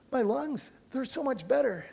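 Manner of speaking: high, loud and quick with relief; imitating a woman overjoyed to breathe again; excited relief. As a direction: burst out thrilled, lungs finally working. Girl's excited relief, retold.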